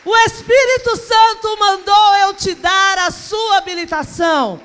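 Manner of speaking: slow, high, very loud announcement; preacher's drawn-out triumphant shout; shouted proclamation. Retold or imitated. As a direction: proclaim it at full force to a crowd. Proclaims the message to everyone.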